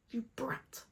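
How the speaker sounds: a soft, spiteful whispered jab; whispered insult. Acted. Muttering at Jeremiah after his peach refusal, whispered. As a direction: hiss "You brat" under your breath.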